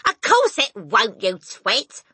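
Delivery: loud, slow put-down; scornful retort. A narrator's reading. Mother to foolish husband, scornful retort. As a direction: scoff at him loudly.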